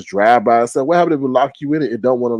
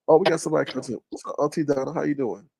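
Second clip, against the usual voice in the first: ordinary talk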